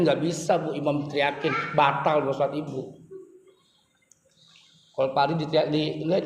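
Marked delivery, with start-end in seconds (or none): none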